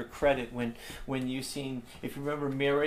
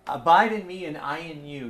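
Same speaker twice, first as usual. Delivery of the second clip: loud proclamation; 2 semitones higher, 7 dB louder than usual. Acted. Preacher quoting Jesus to his congregation, loud proclamation. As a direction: ring it out loud, stressing abide.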